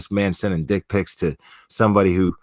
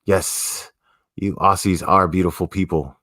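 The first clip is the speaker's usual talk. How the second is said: ordinary talk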